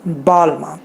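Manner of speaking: बालमा low, with a long "baa"; careful pronunciation. Shown as a demonstration. Host modelling the brand name, careful low pronunciation. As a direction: stretch the first syllable of बालमा. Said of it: "बा, बा कहते हो".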